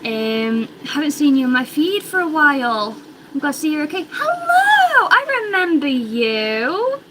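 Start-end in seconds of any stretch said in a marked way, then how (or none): 4.1-7.0 high, delighted hello; excited greeting. Real, not acted. Greets a returning viewer.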